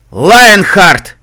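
huge, drawn-out bellow; booming answer. Acted. Ghoul answers Calamity, practically blowing them away, booming. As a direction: blow them away with the name. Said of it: "практически сдувая нас".

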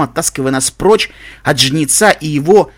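plain reading voice

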